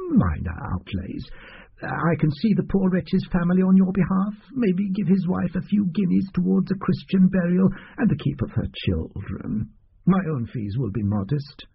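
fussy little doctor. Acted. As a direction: play the oily doctor pretending it's hard. Doctor's voice held.

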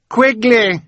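desperate high scream of a name. A narrator's reading. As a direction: scream the name across the water. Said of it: "she screamed".

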